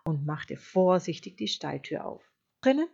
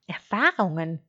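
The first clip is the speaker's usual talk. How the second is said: puzzled, louder one-word question; pondering; 6 dB louder than usual. A narrator's reading. Sheep puzzling over the wolf's word, pondering. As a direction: echo it as if pondering. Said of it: "grübelte Schaf".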